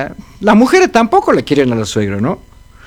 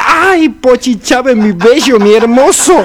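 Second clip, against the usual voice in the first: swooning fan; 6 semitones higher, 4 dB louder than usual. Acted. Old wife swoons over the singer's tattoo.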